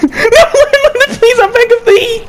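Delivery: high, hammed-up begging through laughter; mock pleading. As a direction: beg theatrically, half laughing. Hammy pleading while laughing.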